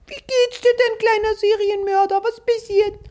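high sugary questioning voice; mock psychologist. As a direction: coo at a killer patronisingly. Psychologist talking to a killer.

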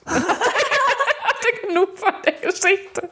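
high, slow, through laughter; laughing aside. Real, not acted. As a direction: giggle the words out slowly. Laughing aside while reading.

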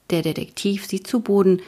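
usual speaking voice

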